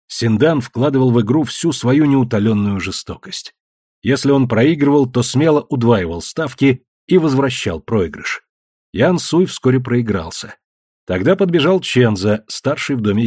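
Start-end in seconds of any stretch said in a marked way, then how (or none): none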